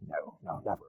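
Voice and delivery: barely audible mimed whisper; whispered mock protest; hushed denial. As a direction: mouth it under your breath, mock denial. Mimics a man's hushed denial.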